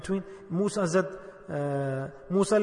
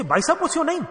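delighted, eager; 10 semitones higher, 5 dB louder than usual. Acted. Overjoyed traveller in the parable.

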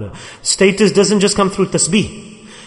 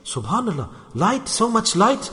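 much softer, awed and breathless; hushed amazement; 2 semitones higher, 7 dB quieter than usual. Retold or imitated. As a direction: gasp it out softly as if seeing a miracle. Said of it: "And he was shocked".

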